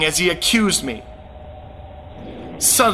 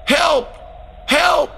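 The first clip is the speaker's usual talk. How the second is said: desperate yell for help; 9 semitones higher than usual. Retold or imitated. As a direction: call out as if burning. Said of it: "was yelling".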